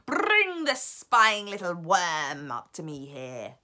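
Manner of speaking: loud, slow, menacing witch command; witch's shout. Acted. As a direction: spit out the order slowly and loudly. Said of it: "Shouted the Grand High Witch".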